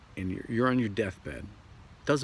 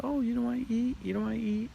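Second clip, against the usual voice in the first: much higher, sing-song teasing; mocking coworker; 14 semitones higher, faster than usual. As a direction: tease like a surprised coworker. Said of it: "And they say".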